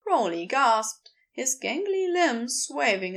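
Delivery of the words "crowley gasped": plain reading voice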